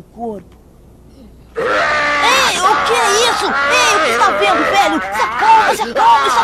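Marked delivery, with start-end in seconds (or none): 1.5-6.4 shouting. Acted. Son yells at raving father.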